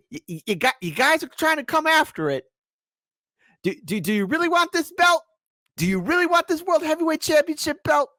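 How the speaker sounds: high-pitched, over-the-top title-defence rant; mock champion trash talk. Host warns rivals off his championship belt, hammed-up high outrage. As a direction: squeal it out like a riled-up champ.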